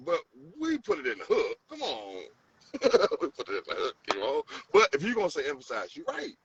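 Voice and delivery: usual speaking voice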